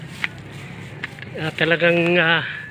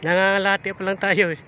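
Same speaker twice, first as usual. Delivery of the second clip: ordinary talk, nothing marked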